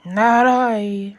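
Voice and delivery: loud gruff grumble; grumbling dog. Acted. The noisy dog refusing, grumbled.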